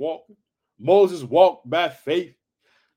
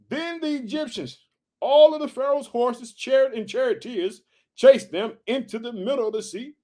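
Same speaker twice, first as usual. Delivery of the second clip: measured, slightly raised reading voice; scripture reading; 5 semitones higher than usual. Acted. Pastor reading Exodus to the congregation, measured reading voice. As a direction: read the Bible passage out steadily.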